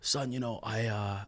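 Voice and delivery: low, soft dad impression; imitating his dad before giving up the voice; dad's voice. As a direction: drop into the soft father voice. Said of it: "do the voice".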